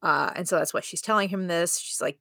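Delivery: ordinary talk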